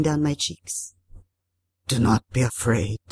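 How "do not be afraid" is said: low, hoarse water spirit; waterlogged spirit, calming; Tarabusao. A narrator's reading. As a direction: reassure in a deep creature voice. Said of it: "hoarse, waterlogged, speaking in an accent so thick".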